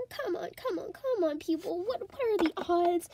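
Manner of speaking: usual speaking voice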